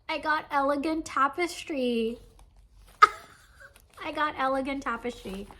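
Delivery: high, loud excited announcement; gleeful reveal. Real, not acted. Vlogger showing viewers her prized kit, gleeful. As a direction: crow it with a burst of laughter.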